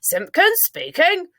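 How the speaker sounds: high, slow self-important phone greeting; very important telephone voice. A narrator's reading. Father answers his house phone in a very important voice. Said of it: "his very important telephone voice".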